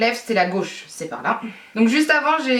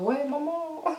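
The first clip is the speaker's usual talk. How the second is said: soft, high mock whine; annoyed childish whine; 5 semitones higher, 8 dB quieter, slower than usual. Shown as a demonstration. Vlogger showing how she whines when annoyed, soft whine. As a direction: imitate your own kiddish whine, quietly. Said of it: "Quand je suis vénère".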